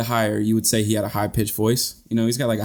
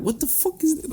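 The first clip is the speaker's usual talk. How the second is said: high, softer mock bafflement; baffled listeners; 4 dB quieter than usual. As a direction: imitate puzzled listeners, pitched way up. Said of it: "they just don't get it".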